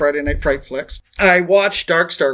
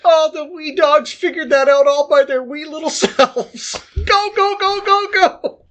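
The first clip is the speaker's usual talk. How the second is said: giddy cheering; 8 semitones higher than usual. Retold or imitated. Drugged at midnight, cheering the cartoon pups to Nick, high squealing chant. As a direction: cheer the pups on like an overjoyed child. Reenacting groggy Paw Patrol gushing.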